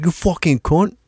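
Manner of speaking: high, put-on British-film swearing; mock British accent. Imitating British film characters cursing, in a high fake British accent. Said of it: "British films, they love to say".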